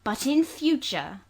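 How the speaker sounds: "But in future" slow and weighted, each word leaned on; marked stress. Acted. Governess warning the servant, "But in future" stressed slowly. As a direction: lean hard on "future". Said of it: "I laid marked stress on the words".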